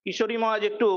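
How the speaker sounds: ordinary delivery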